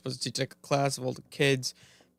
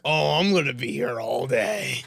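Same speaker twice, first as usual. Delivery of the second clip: resigned groan; 3 semitones lower than usual. Retold or imitated. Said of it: "I'm like".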